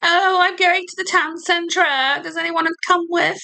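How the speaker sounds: mock posh Brit. Mocking a posh British speaker.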